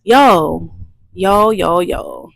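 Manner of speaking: loud, pumped-up yo; hyped greeting. Real, not acted. Vlogger hyping up her viewers, loud excited yo. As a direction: shout it out like a hype intro.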